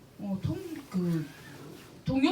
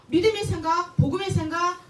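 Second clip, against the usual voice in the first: emphatic preaching; 7 semitones higher, 7 dB louder than usual. Acted.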